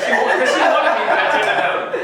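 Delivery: laughing through the words. Real, not acted. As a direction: chuckle while teasing. Teasing claim through laughter.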